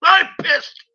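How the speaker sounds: amped up and furious; too much caffeine. Real, not acted. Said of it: "I seem a little bit wired".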